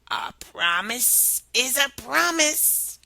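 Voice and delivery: slow whispered frog; slow odd whisper. Acted. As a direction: whisper it slowly and slyly.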